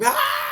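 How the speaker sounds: very high, loud hammed-up scream. Scott caught by the dinosaur, high scream. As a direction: let out a piercing mock scream. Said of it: "She heard Scott scream".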